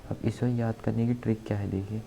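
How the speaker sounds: ordinary delivery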